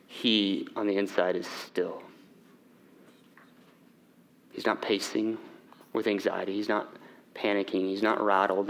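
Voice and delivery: low, soft, intimate preaching; hushed sermon. Acted. Pastor softly telling the church of inner stillness, hushed. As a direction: draw them in close and quiet.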